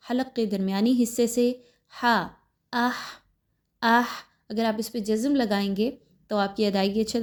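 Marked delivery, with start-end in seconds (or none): 2.7-4.1 اح with a sharp throaty ha; isolated ha. Shown as a demonstration. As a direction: breathe اح out from mid-throat. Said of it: "یہ مخرج ہے ہا کا". (ہا کا مخرج)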